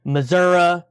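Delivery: "Missouri" said "Missour-uh", ending in uh; hill-country pronunciation. Retold or imitated. Local imitating hill people's speech, "Missouri" as "Missour-uh". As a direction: say "Missouri" as hill folk do, "muh-ZUR-uh". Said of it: "people from the hills out here who say".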